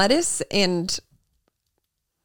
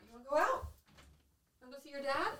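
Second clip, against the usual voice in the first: higher, soft pet talk; talking to a dog; 5 semitones higher, 17 dB quieter, faster than usual. Real, not acted. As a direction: coax the dog gently.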